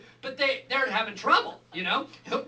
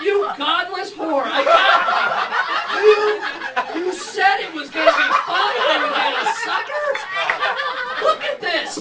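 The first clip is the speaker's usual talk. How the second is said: put-on higher and louder, laughing kid accusing his mom; kid's outrage; 7 semitones higher, 9 dB louder than usual. Betrayed boy at the dentist shouting at his mom, mock outrage.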